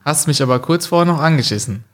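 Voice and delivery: normal talking voice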